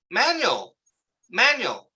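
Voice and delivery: high, falling name call; imitated calling out. Stranger calling his name at the airport, mock calling out. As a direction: act out someone calling across an airport. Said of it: "I hear my name".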